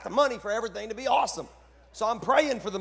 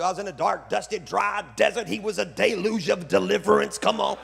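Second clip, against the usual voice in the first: loud, building pulpit cadence; preacher's alliteration; 2 semitones higher, 8 dB louder than usual. Shown as a demonstration. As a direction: drive the alliteration up to a shout. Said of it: "all the alliterations that we do".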